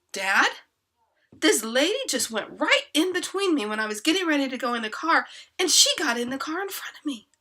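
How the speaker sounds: put-on loud, high-pitched teenage protest; complaining teenager. Teenage boy telling dad about the lady, loud and aggrieved.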